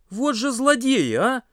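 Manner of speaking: high, drawn-out indignant cry; indignant exclamation. A narrator's reading. Pasha discovers ants in his canned sprats, exclaiming indignantly. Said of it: "воскликнул Паша".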